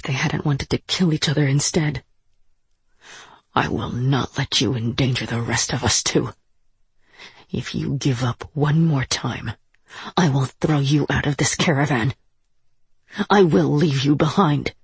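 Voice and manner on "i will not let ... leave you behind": low, measured warning; stern threat. A narrator's reading. Ursula threatens Duho in a quarrel.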